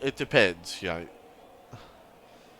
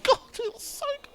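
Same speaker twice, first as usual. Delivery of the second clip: much higher, delighted outburst; excited squeal; 7 dB louder than usual. Real, not acted. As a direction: burst out thrilled at the move. Thrilled reaction to a pass.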